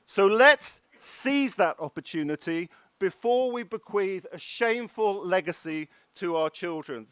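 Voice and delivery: usual speaking voice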